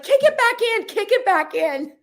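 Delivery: imitating a high, urgent cry; high, loud retold order, dragged out at the end; hammed-up frantic squeal; panicked mock yell. Acts out friend's panicked order.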